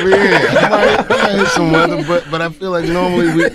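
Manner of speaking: laughing at his own pilot joke. Real, not acted. Guest to host, laughing at his own pilot joke.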